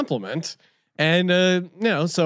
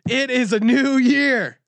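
jacked-up boast; 6 semitones higher than usual. Acted. Host boasting he is jacked up on meat, high hype. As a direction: hammer each boast like a pumped-up pitchman.

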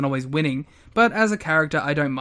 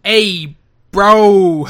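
loud hammed-up New York greeting; mock New York accent; 10 dB louder than usual. Podcaster mocking a wrestler's attempted New York accent, loud and hammed-up. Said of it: "a New York accent".

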